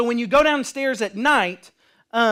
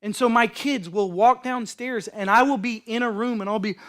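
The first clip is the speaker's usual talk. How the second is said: normal talking voice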